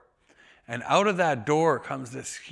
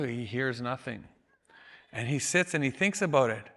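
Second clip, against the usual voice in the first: normal talking voice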